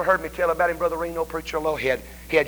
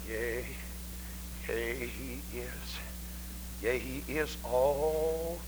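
hushed awe; 4 semitones lower, 12 dB quieter, slower than usual. Retold or imitated. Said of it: "She said".

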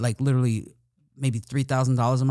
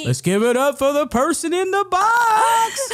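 high, slow, booming emcee call; wedding MC hyping a crowd; hype MC voice; 5 dB louder, slower than usual. Retold or imitated. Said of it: "same wedding energy".

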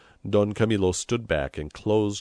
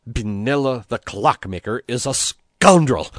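louder, building to an outburst; indignant accusation; 5 semitones higher, 9 dB louder than usual. A narrator's reading. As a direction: denounce him with rising outrage. Priest protests to Christ about referee.

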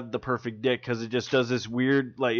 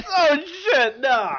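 loud, high wail of dismay; real high-pitched laughing dismay; laughing outcry; 13 semitones higher, 7 dB louder, slower than usual. Real, not acted. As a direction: cry out "no" through laughter. Cries out at the new rule.

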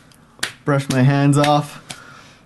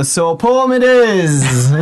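slow, raised theatrical declaration; bard announcing a poem; 4 semitones higher, 5 dB louder, slower than usual. Acted. Bard turns to the waiting guests, theatrically announcing. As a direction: proclaim it to an audience, drawn out.

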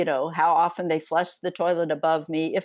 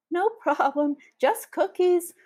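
much higher nervous squeak; squeaky voice; 12 semitones higher than usual. Acted. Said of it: "My voice is a squeak".

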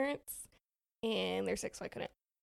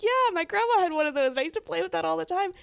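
eager admission; 7 semitones higher, 8 dB louder, faster than usual. Retold or imitated. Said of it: "I'm like".

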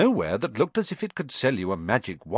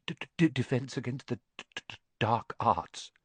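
softer, fast, stuttering mutter; muttered stammer; 6 dB quieter than usual. A narrator's reading. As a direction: mumble it as if you dread the subject. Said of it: "muttered Professor Quirrell".

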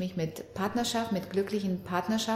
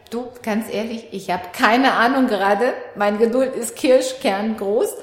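exasperated mother; 2 semitones higher, 9 dB louder than usual. Retold or imitated. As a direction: let her hear your patience is nearly gone. Mother tells exasperated daughter limits.

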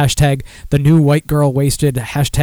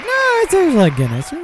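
squeaky innocent excuse, pitched way up; high innocent fib. Retold or imitated. Fibbing to coworkers in a high innocent voice. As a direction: play dumb in a high voice. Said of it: "I'll just be like".

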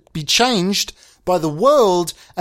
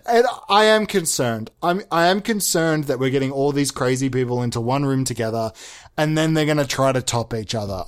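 ordinary delivery

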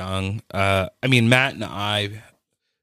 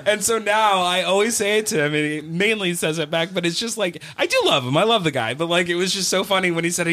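giggly high voice; 10 semitones higher than usual. Real, not acted. Explaining his friendship with Steve while still giggling, high. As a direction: still laughing, voice pitched up.

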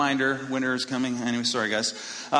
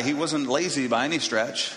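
ordinary talk

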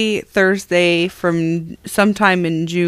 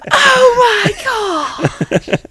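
high, long-drawn mock outrage; mock shock; much slower than usual. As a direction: stretch it out high, imitating his horrified reaction. Imitating his shocked reaction.

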